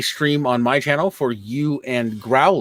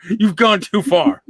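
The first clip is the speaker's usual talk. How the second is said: mock scolding; 7 semitones higher, 3 dB louder than usual. Mock scolding after a joke.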